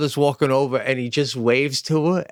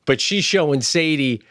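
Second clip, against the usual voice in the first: ordinary talk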